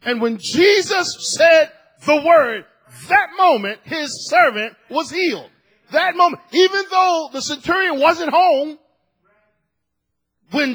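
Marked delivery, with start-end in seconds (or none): 0.0-8.8 loud, raised preaching voice; forceful preaching. Real, not acted. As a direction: drive each phrase home to the congregation.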